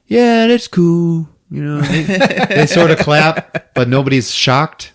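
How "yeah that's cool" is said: slow, flat-toned shrug of a line; mock lukewarm crowd; unimpressed. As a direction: say it with a bored shrug. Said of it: "whole crowd is just like".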